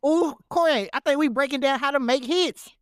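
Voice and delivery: ordinary talk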